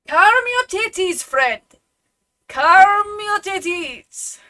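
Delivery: booming Russian brute shouting, high and loud; aggressively shouting in a Russian accent. Acted. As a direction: bellow it in a thick Russian accent. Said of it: "quite loud Russian, vaguely Russian sounding".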